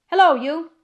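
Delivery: high, loud hail; hailing shout. A narrator's reading. Guard to the horseman, loud rough hail. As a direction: shout to get the rider's attention.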